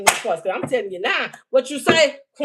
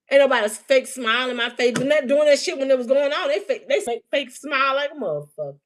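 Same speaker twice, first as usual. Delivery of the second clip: usual speaking voice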